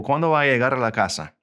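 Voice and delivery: quick, connected Spanish; natural-speed repeat. Shown as a demonstration. Teacher repeats the question for learners, fast and fluent. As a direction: run "¿Cuándo va a llegar a la casa?" together briskly.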